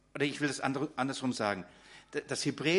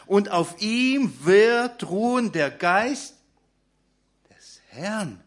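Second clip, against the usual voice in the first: slow, louder, higher, every word weighted; solemn emphasis; 5 semitones higher, 6 dB louder, much slower than usual. Acted. Preacher proclaims Isaiah's promise to the congregation, slow and emphatic. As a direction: let the promise ring out to the church. Preacher reads key verse.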